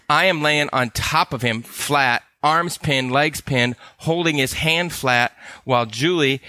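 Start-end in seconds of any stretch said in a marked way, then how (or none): none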